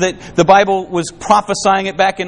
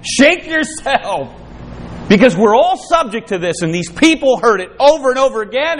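usual speaking voice

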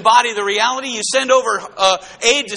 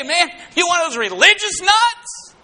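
higher, half-shouted scoff at a religious nut; mocking scoff; 7 semitones higher than usual. Preacher mimicking a scoffer who brushes off God talk, mocking shout. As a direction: sneer it out like a skeptic.

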